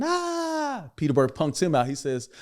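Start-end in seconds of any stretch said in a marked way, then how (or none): 0.0-0.9 a sudden yelp, much higher; mock hype scream; high-pitched scream. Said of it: "he starts screaming".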